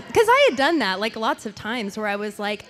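usual speaking voice

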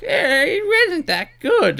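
high, slow, whiny nerd. Pretend. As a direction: sneer it like a basement nerd critic. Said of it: "put on my nerd voice".

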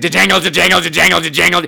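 rapid rhythmic jangle imitation; jangling bracelets. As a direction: chatter it like jangling tambourines. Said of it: "It was like".

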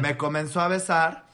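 ordinary talk, nothing marked

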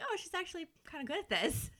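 high, quick, impressed aside; pleasantly impressed friends. Retold or imitated. Voicing excited friends watching a bedroom performance, impressed. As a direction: imitate friends discovering real talent. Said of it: "like, all excited".

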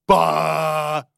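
loud, drawn-out dumb bellow; Robbie. Acted. Host mocking a friend with an impression, loud dopey bellow. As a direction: bellow nonsense syllables as a crude impression. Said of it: "Robbie sounds like this".

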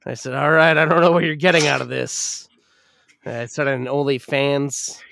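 normal talking voice

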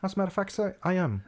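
put-on high, polite English accent; reply as a hammed-up English gentleman; mock English accent, prim and high. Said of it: "with that accent".

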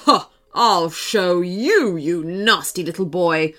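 put-on snarling storybook threat to a child; threatening dragon.

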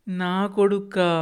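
put-on a little boy baffled by the thought; child's amazement. A narrator's reading. Grandson puzzled at having a son someday, slow high wonder.

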